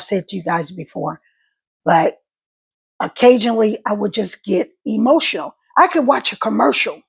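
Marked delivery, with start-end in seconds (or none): none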